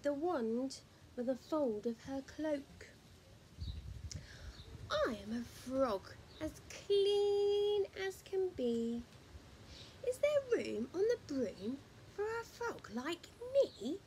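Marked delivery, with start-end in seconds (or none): 4.9-14.0 high croaking frog voice; a polite frog, croaking; pitched-up frog croak; croaky frog. Acted. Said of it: "said with a croak".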